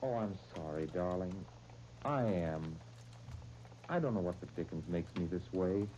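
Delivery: soft, low apology to a loved one; low-voiced, quiet regret after snapping; contrite apology. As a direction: back down gently, sorry and subdued. Husband apologises after quarrel.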